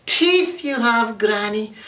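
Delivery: put-on high, slow, frightened girl; girl scared of the wolf's teeth. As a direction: ask it wide-eyed and slow.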